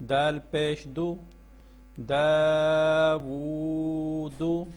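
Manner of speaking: slow sing-song spelling chant, drawing out each joined word; measured classroom recitation of the Noorani Qaida. Shown as a demonstration. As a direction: chant each letter and its vowel for pupils to repeat. Teacher chants spelling drill.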